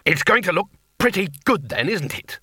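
irritated, sarcastic retort; War needles Death, testily; testy. Acted. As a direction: snap back at Death. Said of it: "said War testily".

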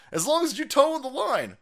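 usual speaking voice

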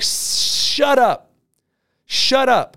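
high, punchy shut-down; emphatic silencing. Retold or imitated. Speaker silencing his scared relative, emphatic order. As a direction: cut off his worrying firmly.

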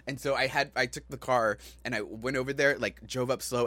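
usual speaking voice

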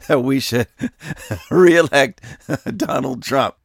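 words broken by real laughter; giggling retelling of the gaffe; laughing. Real, not acted. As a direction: laugh through the quote in disbelief.

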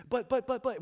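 high, loud mock toddler objecting to her parents; whiny child protest. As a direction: imitate a toddler talking back. Child contesting parents' command.

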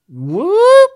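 loud swooping "whoop" sound effect; cartoon upward swoosh made by voice; rising whoop. Shown as a demonstration. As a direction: slide sharply up in pitch on it. Imitating motion with a noise.

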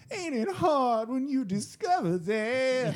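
high, slow nasal sneer. Retold or imitated. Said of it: "his nasally voice".

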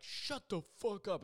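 high-pitched, drawn-out mock yell; retold angry retort. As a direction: imitate an irritated dude lashing back. Drug user snaps back in quarrel.

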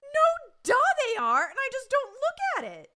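hammed-up high teenage yell. Daughter fighting dad over emails, high hammed-up yelling. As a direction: holler it like an outraged daughter. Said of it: "she just starts yelling at him".

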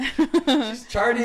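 slow, high laughing. Real, not acted. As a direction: stretch it out laughing.

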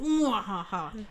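slow villain laugh mwahaha; mad scientist. Pretend. Joking Matt must join podcast.

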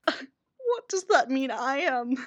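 high-pitched indignant voice broken by laughter; mock offended, laughing. Imagining listeners hurt by "old guy from the seventies", mock offended. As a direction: laugh through a put-on wounded protest.

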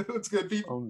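high goofy announcer greeting; playful character voice; put-on voice. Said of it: "that voice is great".

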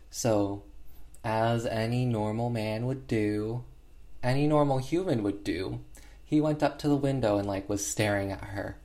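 ordinary talk, nothing marked

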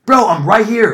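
high, loud holler; re-enacted shout down the street; retold yelling. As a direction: yell to get a passerby's attention. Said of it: "I'm yelling at this tweaker".

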